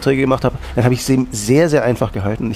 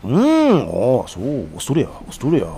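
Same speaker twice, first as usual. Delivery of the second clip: imitating the bartender. The bartender echoes his words back in mock Japanese. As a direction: parrot the words back slowly.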